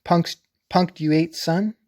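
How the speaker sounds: "Punks-, punctuate" said slowly with a break after punks; "son?" tossed up high after "punctuate"; punk split off. Shown as a demonstration. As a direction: pull "punctuate" apart into punks and punctuate. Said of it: "the joke's on you".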